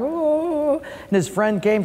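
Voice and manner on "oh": high, drawn-out fake wail; hammed-up crying moan; mock crying wail. Preacher playing the crying man in a joke, mock wailing. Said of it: "this guy who was crying".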